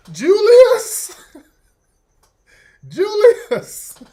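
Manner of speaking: high, loud laughing cry of a name; hammed-up high call. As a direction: shriek the name like a startled diva. [name] calling out in panic.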